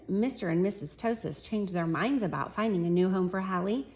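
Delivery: plain reading voice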